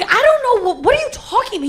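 retold panicky denial, very high pitch; freaked out. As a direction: imitate flustered, high-pitched disbelief. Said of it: "and I'm freaked out".

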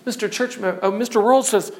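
ordinary talk

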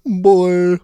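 loud, exaggerated Poway vowel; Poway accent. Host mimicking the singer's pronunciation, Poway accent. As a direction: belt it in a mock Poway accent. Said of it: "that Poway accent".

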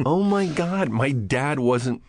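high, drawn-out dawning realization; acted-out epiphany to himself; sudden realization. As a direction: blurt it high, then slow right down. Realising aloud to himself in kitchen.

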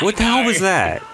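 shouted high and loud in disbelief; shocked outburst. Real, not acted. Friend shouts at the screen in shocked disbelief. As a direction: yell it, stunned by what you saw. Outraged at Corey's reaction.

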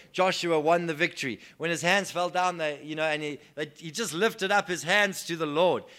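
loud proclaiming preacher, names of God ringing out; rousing sermon declaration, loud and stately; full-force preaching. Acted.

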